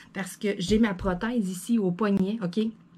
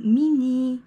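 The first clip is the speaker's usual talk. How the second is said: louder, higher call to the cat; scolding call; 5 semitones higher, 6 dB louder than usual. Real, not acted. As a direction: call out to make the cat move. (appel de réprimande au chat)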